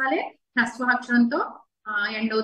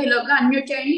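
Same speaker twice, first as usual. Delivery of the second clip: ordinary delivery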